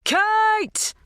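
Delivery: very high, loud shout of a name; bellowing. A narrator's reading. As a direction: bellow through a closed door. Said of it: "cupped his hands ... and bellowed".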